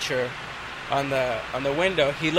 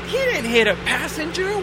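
protesting; 11 semitones higher than usual. Retold or imitated. Arguing with the captain.

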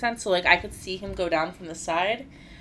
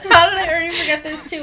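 fast, higher and louder through laughter; laughing; 11 semitones higher, 11 dB louder, faster than usual. Real, not acted. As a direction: crack up while asking. Laughing at her own forgetfulness.

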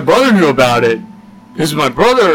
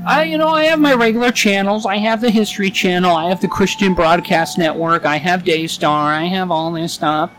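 high mock mom voice, chatty and fast; imitating mom; 6 semitones higher than usual. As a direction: put on a prim high voice for mom listing her channels. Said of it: "And she goes".

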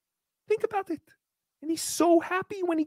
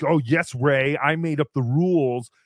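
a lower, smug imitation of Chad; mock Chad; 10 semitones lower than usual. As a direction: play Chad as smug and self-satisfied. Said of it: "Chad doing his joke".

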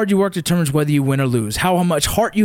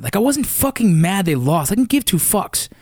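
ordinary delivery

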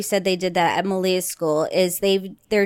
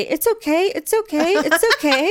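lightly higher, soothing repeat; soothing lady; 9 semitones higher than usual. Retold or imitated. Kid repeats what the secretary kept saying. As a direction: echo the lady's calming words. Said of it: "kept hearing her say".

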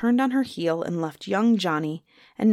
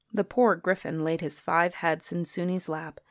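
ordinary delivery

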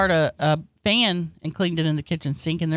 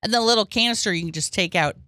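normal talking voice

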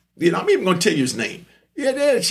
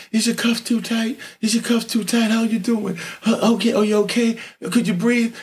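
soft-spoken chaplain; 4 semitones higher than usual. Retold or imitated. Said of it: "and real soft spoken".

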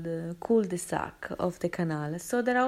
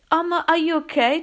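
high, raised voice of concern; worried check; 8 semitones higher, 6 dB louder than usual. Retold or imitated. Witness checking on the dog after the kick, high and concerned. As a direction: call it out high and anxious.

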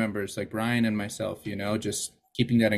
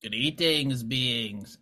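alien voice; 3 semitones higher, much slower than usual. Pretend. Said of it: "That's my best, uh, alien voice".

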